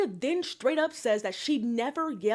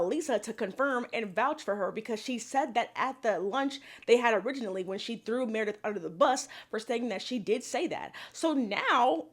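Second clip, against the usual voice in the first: ordinary talk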